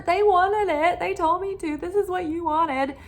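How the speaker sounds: hamming up the partner's excuse; lightly voiced indignant protest; defensive mock protest.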